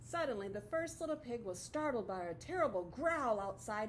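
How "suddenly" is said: usual speaking voice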